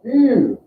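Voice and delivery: "Ew" pitched high; high "Ew" demo; teacher sounds out "Ew"; vowel demo. Shown as a demonstration. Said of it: "like an E W sound".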